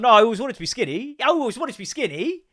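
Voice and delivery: high, loud, whiny mock voice; mock whiny dieter. Mocking dieters who suddenly want to be skinny, high whine. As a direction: squeal it like a fake convert.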